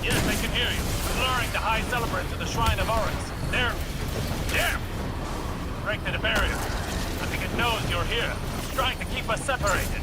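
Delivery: tinny radio transmission, strained; urgent voice over a comm channel; distant comm voice. Acted. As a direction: speak as if through a crackling comm link. Osiris calling over strained comm.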